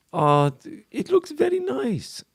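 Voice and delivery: high, slow put-on podcast voice. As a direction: croon it like a cheesy podcast host. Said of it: "the worst podcast voice ever".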